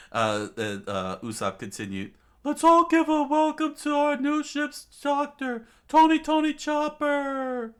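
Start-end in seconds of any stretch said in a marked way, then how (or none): 2.4-7.7 high, loud master-of-ceremonies yell; shouted announcement. A narrator's reading. Usopp welcoming the new ship's doctor, shouting. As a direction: proclaim the name to the whole crowd.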